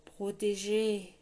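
slow, rumbling word with otherworldly timbre; rumbling reproach. A narrator's reading. Grey man repeating his warning, rumbling reproach. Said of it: "répéta-t-il".

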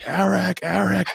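high yelled name through the glass; retold yelling. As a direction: call the name as if banging on the window. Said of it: "screaming his name".

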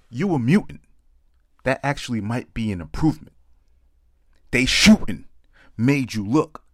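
punchy spoken-word poem, lines thrown out loud; comic slam poem read to the crowd; poem performance. Acted. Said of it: "I call it mutants".

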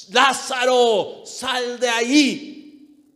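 loud, high, stretched-out command. Acted. Preacher as the Lord summoning buried Lazarus, commanding shout. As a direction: order the dead man out, each word drawn long. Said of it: "le dijo con voz de mando".

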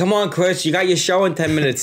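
1 urging friend. Retold or imitated. Friends rushing gambler to show.

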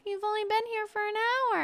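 high whiny pleading voice; mock relative begging her to stay; whiny relative protest. Relatives protesting she leaves so soon, high and whiny.

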